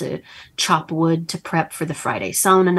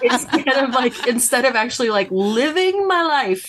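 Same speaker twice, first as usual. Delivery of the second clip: higher, half-laughing overachiever rant; mock frantic self, laughing; 7 semitones higher than usual. Author mocks her own overachiever urge, high and laughing. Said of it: "I'm like".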